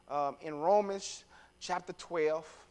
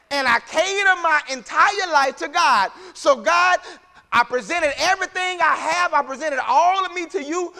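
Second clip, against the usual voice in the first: loud, raised preacher's delivery; impassioned preaching; 9 semitones higher, 9 dB louder than usual. Real, not acted. Preacher telling the congregation what worship is, impassioned preaching. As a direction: preach it out with rising, falling cadence.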